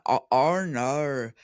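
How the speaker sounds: exaggerated Australian vowels; attempted Australian accent, vowels bent. Shown as a demonstration. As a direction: say it with a broad Aussie twang. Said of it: "doing an Australian accent".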